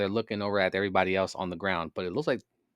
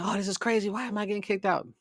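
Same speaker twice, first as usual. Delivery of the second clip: high, whiny mock outrage; mock upset woman; 11 semitones higher than usual. Imitating a wrestler upset at being kicked out, high and whiny. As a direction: mimic her protesting being thrown out. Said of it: "kinda be upset".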